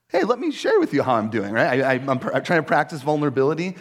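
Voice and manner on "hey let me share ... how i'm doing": high, bright-eyed mock eagerness, said louder; mock eager overshare. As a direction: play up the eager oversharing, half joking. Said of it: "I'm like".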